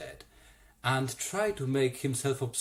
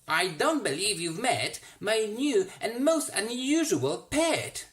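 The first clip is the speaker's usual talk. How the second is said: put-on smug boy voice; 8 semitones higher, 4 dB louder than usual. Roy shows off his pet.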